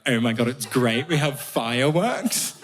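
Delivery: put-on gushing English accent, slow finish; mock English accent. English people explaining their tradition to an Irishman, gushing English accent. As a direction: imitate English delight, stretching the last words. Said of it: "all these mad English traditions".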